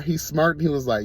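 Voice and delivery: normal talking voice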